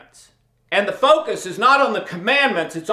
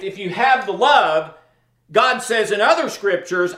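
ordinary talk

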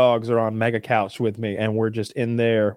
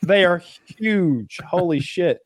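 amazed; 6 semitones higher, slower than usual. Real, not acted. Marvelling at dog's testicles.

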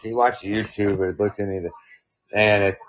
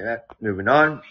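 ordinary talk

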